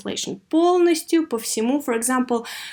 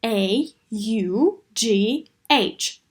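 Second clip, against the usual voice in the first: slow, letter by letter spelling; much slower than usual. Shown as a demonstration. Teacher spelling a tricky word for the class, slow and clear. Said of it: "let's spell it".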